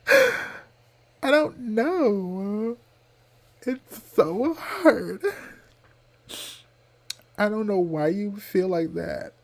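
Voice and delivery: high, slow, whiny crying-voice quote; Kim K impression. Vlogger mimics a tearful Kim K, high whiny plea. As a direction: pout and whimper like a weepy reality star. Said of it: "if you know where that's from, that's Kim K".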